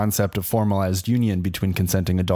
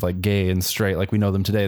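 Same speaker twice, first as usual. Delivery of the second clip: ordinary talk, nothing marked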